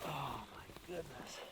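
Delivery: awed whisper. Real, not acted. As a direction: breathe it out in awe. Awe after the kill.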